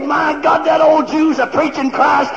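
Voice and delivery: loud, high-pitched revival shouting; full-force preaching. Real, not acted.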